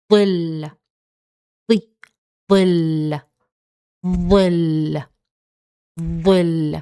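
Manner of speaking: ظل with the tongue between the teeth; Arabic word demo. Shown as a demonstration. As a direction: model ظل for learners. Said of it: "And then we have".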